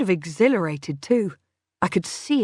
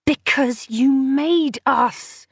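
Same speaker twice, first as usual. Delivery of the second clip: slow, higher hissed fury; furious hiss; 6 semitones higher, 3 dB louder, much slower than usual. Acted. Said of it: "she hissed".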